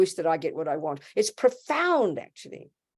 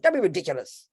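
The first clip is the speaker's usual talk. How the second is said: usual speaking voice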